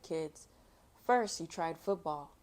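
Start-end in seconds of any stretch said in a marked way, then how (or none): none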